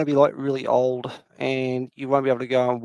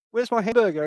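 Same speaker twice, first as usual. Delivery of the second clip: indignant demand; 8 semitones higher than usual. Retold or imitated. Demanding the promised hamburger from friend.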